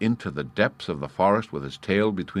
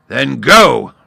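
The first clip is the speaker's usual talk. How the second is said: much louder roared command; roaring; 6 semitones higher, 14 dB louder than usual. A narrator's reading. Lion roaring the start of the fight. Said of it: "roared the lion".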